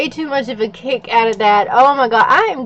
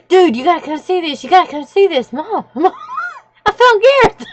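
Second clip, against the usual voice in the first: put-on excited kid; 6 semitones higher than usual. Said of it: "He goes".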